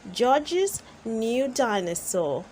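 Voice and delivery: plain reading voice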